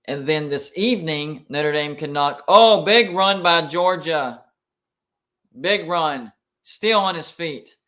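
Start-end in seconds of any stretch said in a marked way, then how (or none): 2.5-7.8 louder, drawn-out oh at a big run; excited reaction. Real, not acted. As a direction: react live to the play breaking open. Live big run while watching.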